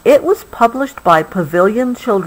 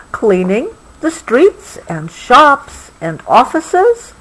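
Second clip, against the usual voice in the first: slow storybook reading, a touch higher; story reading; 5 semitones higher, slower than usual. A narrator's reading. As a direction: read it out slowly to a child. Reading book text.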